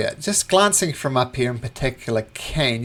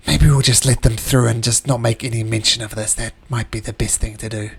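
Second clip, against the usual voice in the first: ordinary delivery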